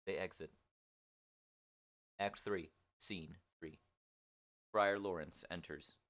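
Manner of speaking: low, soft, neutral read of the directions; stage directions. A narrator's reading. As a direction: drop down and read the directions flatly.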